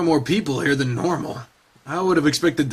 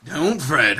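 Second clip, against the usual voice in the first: ordinary delivery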